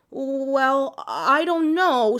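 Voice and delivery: high, slow nervous stalling; frightened girl making excuses; nervous hesitation. Acted. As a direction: back away uncertainly. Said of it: "she said".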